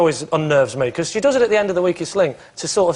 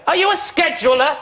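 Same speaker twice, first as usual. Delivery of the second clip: high, slow mocking interrogation; Anne Robinson; 9 semitones higher than usual. TV presenter accosting a comedian at a meal, haughty mimicry. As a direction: put on a drawn-out, pitched-up voice. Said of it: "Is that how she really talks?".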